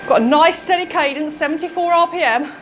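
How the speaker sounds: ordinary delivery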